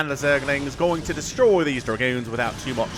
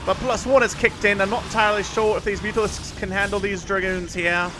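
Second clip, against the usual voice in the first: put-on Aussie accent; 4 semitones higher than usual. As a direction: cast the game like a cheerful Aussie bloke. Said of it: "we'll cast with an Aussie accent for the next two minutes".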